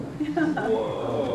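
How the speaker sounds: a quiet stunned whoa; awed whoa. Real, not acted. Storyteller recalling spotting Frank Lloyd Wright, awed. Reacting to seeing famous architect.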